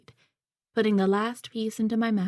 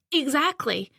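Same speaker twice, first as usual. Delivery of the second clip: quick, keen, higher yes; eager agreement; 6 semitones higher than usual. A narrator's reading. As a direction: jump in to agree. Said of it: "desperate to agree with anything".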